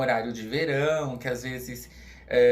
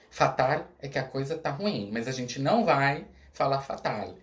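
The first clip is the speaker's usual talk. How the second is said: usual speaking voice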